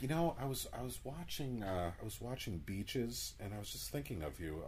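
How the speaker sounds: hushed, intimate confession; soft late-night voice. Pretend. Acted late-night caller.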